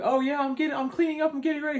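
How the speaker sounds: very high, chirpy girlfriend imitation; high mock Nikki voice. Girlfriend insisting she is coming along, very high mock voice. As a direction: squeak it brightly, far above your own voice. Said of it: "And she's like".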